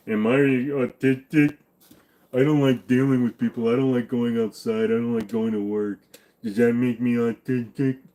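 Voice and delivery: lightly mock a lazy self-diagnoser; whiny WebMD self-diagnoser. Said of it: "the rest of you fucktards go on to web M D".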